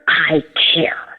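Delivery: whispered sincerely; hushed "I care". Retold or imitated. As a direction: say it like a secret promise. Showing the teacher she cares.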